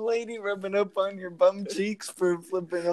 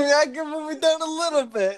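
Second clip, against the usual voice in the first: higher-pitched teasing echo; mock-pleading, pitched up; mocking imitation; 7 semitones higher than usual. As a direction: tease your friend by parroting them in a higher voice. Mockingly echoes the teller's quote.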